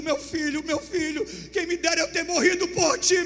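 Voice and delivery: a father beginning to wail; grieving cry. Acted. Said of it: "gritando, dizendo". (lamento crescente de pai)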